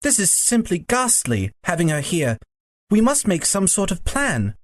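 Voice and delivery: high schoolboy voice, urgent; fretful Diggory. A narrator's reading.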